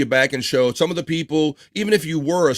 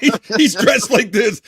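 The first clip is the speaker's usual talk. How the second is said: slower, louder, higher mock explanation; hammed-up Sean pointing at the costume; imitating Sean; 9 semitones higher, 5 dB louder, slower than usual. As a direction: spell it out like it's obvious. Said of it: "Sean said".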